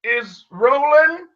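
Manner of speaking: high, slow, drawn-out announcement; proclaiming. Acted. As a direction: proclaim the name to the whole room. Character announcing himself to hushed crowd.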